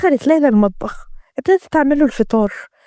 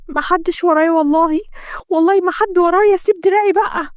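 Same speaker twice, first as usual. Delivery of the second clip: high, desperate pleading swearing innocence; pleading maid; 6 semitones higher than usual. A narrator's reading. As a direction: beg him to let go. Layla pleading. (توسل عالي يائس)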